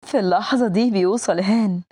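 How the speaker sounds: ordinary delivery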